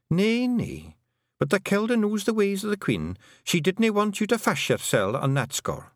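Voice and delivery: high-pitched Scots little woman; Fionn. A narrator's reading. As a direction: answer high, in broad Scots. Fionn answers Tiffany in dialect.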